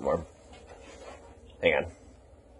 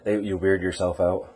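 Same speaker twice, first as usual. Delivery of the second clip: ordinary talk, nothing marked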